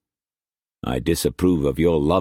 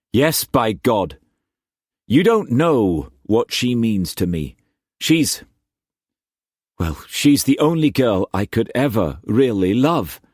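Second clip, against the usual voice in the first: put-on higher, urgent young man's voice; anxious young Cullum; 5 semitones higher than usual. Cullum pleading his love to Struan, higher and urgent. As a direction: plead like a lovesick youth.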